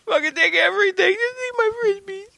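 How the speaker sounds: high, crying plea to spare his Frisbees; mock pleading victim. Pretending to beg scalpers to leave his Frisbees, high and crying. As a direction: beg the robbers like a panicked victim.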